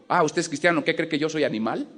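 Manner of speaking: a retold policeman, sharply offended; angry retort. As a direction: snap back, offended. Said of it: "se subió bien enojado".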